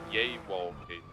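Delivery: "yay walking" with a Y for the J; Swedish-style pronunciation. Shown as a demonstration. Colleague teasing about Swedes, "yay walking" said with a Y. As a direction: start "yay walking" on a soft Y sound. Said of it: "the Swedes refer to that as".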